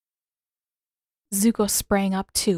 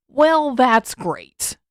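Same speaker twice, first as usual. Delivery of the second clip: slow, loud, dripping sarcasm; sarcastic; 5 semitones higher, 7 dB louder, much slower than usual. A narrator's reading. Sokka mocking the earthbending claim, sarcastic. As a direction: drag out the fake praise. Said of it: "Sokka interjected sarcastically".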